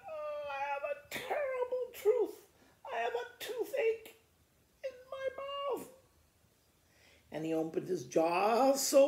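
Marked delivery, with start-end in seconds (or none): 0.1-5.9 high, weepy whining crocodile; crying crocodile. Acted. Crocodile whimpering to the dentist about toothache. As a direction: wail like a big baby in pain.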